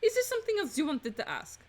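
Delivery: put-on polite question in a French accent. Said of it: "doing a French accent".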